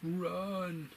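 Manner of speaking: sudden high cry of run; urgent cry. Real, not acted. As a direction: yell a warning at the screen. Yelling at characters on screen.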